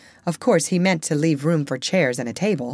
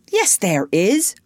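put-on eager, raised boyish contradiction; excited cry; 3 semitones higher, 4 dB louder, slower than usual. A narrator's reading. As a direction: cry it out with drawn-out certainty. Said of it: "cried Peter".

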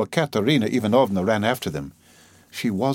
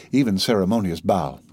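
ordinary delivery

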